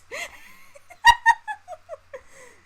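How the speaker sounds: shrill burst of real laughter; a loud high squealing laugh. Real, not acted. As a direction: crack up loudly. Laughs after the outburst.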